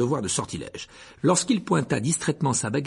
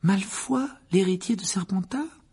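skeptical; 8 semitones higher, slower than usual. A narrator's reading. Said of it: "murmura Hermione d'un ton sceptique".

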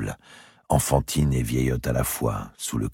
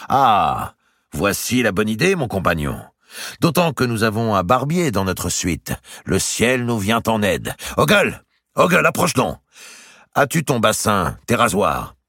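higher, sneering knight's voice; mocking knight; 8 semitones higher, 4 dB louder than usual. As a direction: play the cruel jailer savouring it. Narrator voices Maltravers mocking.

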